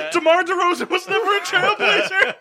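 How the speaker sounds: loud, high, laughing protest. Real, not acted. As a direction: crack up while calling out the mistake. Laughing at friend's wrong guess.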